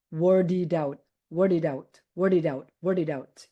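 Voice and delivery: word it out repeated with the d run into it; linked as one word. Shown as a demonstration. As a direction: say word it out as wordidout. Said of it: "pronounce it as if it's only one word".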